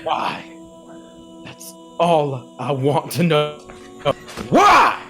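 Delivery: barbarian shout. Acted. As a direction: bellow the question in fury. Barbarian demanding answers, yelling.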